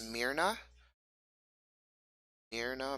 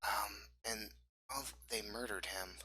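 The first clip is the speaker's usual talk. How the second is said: hushed; 11 dB quieter than usual. Acted. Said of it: "not so loud".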